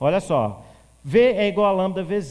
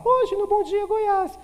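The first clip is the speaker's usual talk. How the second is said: much higher anchor voice; slower than usual. Shown as a demonstration. Teacher demonstrates raised pitch with a squeaky, higher news intro. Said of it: "Ele vai falar".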